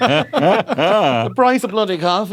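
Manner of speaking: slow, laughing grumble. Real, not acted. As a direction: moan about prices while laughing. Laughing old-man grumble.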